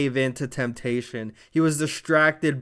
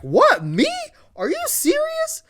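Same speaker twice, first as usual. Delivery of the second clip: loud, high outraged yelp; angry disbelief; 4 dB louder than usual. Retold or imitated. Imitating Jimmy snapping back at Solo, loud angry disbelief. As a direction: play Jimmy's furious disbelief, voice jumping up. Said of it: "he starts getting angry".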